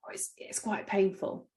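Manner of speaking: a patient hiding real pain in a soft voice; pained understatement. Retold or imitated. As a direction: play it down through the pain. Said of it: "a tone of voice".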